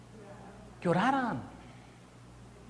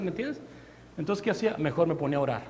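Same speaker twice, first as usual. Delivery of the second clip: ordinary talk, nothing marked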